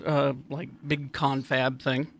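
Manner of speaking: ordinary talk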